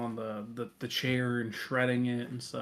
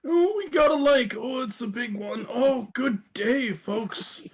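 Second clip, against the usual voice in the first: put-on higher-pitched, drawn-out old-timer voice; old folks' home resident; 9 semitones higher, 4 dB louder than usual. Old resident griping about a terrible nurse, high drawn-out character voice.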